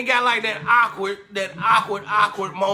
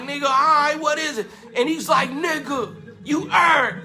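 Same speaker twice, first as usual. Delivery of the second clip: hyped; 5 semitones higher than usual. Retold or imitated. Excited exchange over his name.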